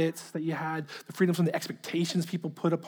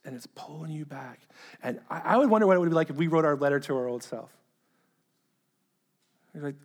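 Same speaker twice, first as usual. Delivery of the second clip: ordinary delivery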